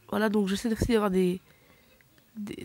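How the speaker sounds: ordinary delivery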